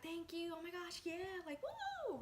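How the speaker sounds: giddy excitement. Retold or imitated. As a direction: gush like you just got the gig. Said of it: "We're super excited".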